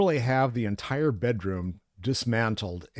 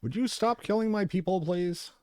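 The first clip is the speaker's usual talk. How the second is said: much higher, mock-pleading villain voice; whiny villain; 10 semitones higher than usual. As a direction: play the boss begging you to stop. Voicing the game boss's complaint.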